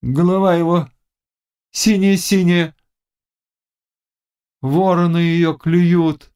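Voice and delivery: high, slow seer's voice; witch in trance. A narrator's reading. Witch describing her brother's death, entranced. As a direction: draw out the gruesome vision on a high pitch.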